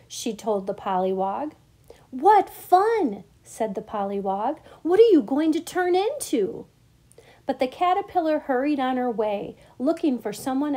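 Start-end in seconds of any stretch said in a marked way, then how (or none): 2.1-3.2 high, loud burst of delight; excited. A narrator's reading. Eager pollywog hears the news. 4.8-6.7 high, loud excited question; eager curiosity. A narrator's reading. Eager pollywog asks caterpillar.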